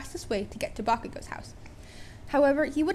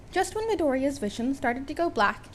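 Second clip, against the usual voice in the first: ordinary delivery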